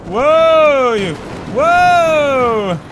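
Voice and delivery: loud high startled whoa. Real, not acted. Gamer startled as the monster swings wildly. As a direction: jolt back in surprise.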